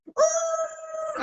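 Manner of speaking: a sudden falsetto shriek of alarm; high squeal of disbelief; high mock shriek. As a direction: shriek high like you just got spooked. Reacts to crack smoke in truck.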